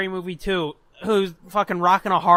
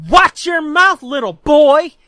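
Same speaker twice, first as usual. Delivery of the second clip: loud, raised-voice mock scolding; angry retort; 5 semitones higher, 9 dB louder, slower than usual. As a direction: imitate an offended girl telling off a heckler. Girl snaps back at heckler.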